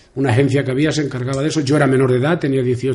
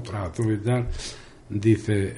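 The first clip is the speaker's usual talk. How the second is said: ordinary talk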